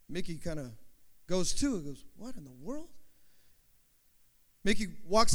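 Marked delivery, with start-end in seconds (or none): 2.1-2.9 soft, puzzled reaction; hushed bewilderment. Acted. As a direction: wonder aloud under your breath. Startled man reacts to shots.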